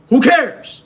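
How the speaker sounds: very high, loud yell; exasperated shout. Real, not acted. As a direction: shout it down dismissively. Bursts out against whining excuses.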